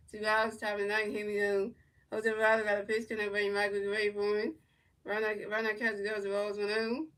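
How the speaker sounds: lightly voiced broadcaster calling the at-bat; mock play-by-play. Fan calling the game live to stream viewers, mock broadcaster. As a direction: narrate each pitch like a TV booth.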